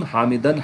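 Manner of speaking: حامدن ending in fatha, hamidan; with zabar. Shown as a demonstration. Teacher shows the zabar form, hamidan. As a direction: end حامدن on -an. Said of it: "زبر کے ساتھ".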